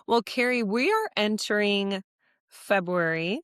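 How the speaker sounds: ordinary talk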